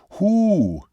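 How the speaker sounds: high, emphatic questioning "who". A narrator's reading. Seminole places his finger on Juno, asking emphatically. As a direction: demand the name with real emphasis. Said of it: "he inquired emphatically".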